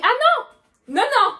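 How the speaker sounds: high, loud mock refusal; loud protest. Girl refusing the cashier paying for her, loud protest. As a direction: protest loudly but playfully.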